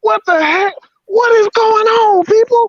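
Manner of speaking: very high, crying shout of disbelief; overwrought, emotional. Real, not acted. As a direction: cry out to everyone, voice way up high. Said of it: "I'm just so emotional right now".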